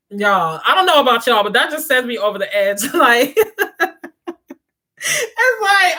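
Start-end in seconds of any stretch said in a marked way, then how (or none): 2.8-5.9 high-pitched laughter breaking into words; laughing. Real, not acted. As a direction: laugh through it, squealing. Laughing at own irritation.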